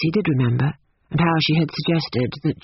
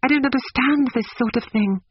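put-on high, distressed girl's protest; mildly overwhelmed young woman; gasped; 9 semitones higher than usual. A narrator's reading. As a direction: plead helpless confusion, pitched up. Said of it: "gasped Lucy".

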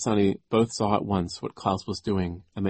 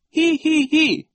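very high, loud hee-hee-hee; giggling; 8 dB louder, slower than usual. A narrator's reading. Nero laughs at the children, high spoken giggle. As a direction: giggle in three piercingly high syllables. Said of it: "Nero stopped giggling".

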